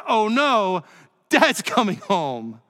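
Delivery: imitating a kid's worried "oh no"; mock dread. Children dreading dad coming home after mom's warning, mock dread. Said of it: "our perspective changed about dad coming home".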